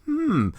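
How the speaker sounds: high, suggestive mock hmm; knowing hum. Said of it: "It was like".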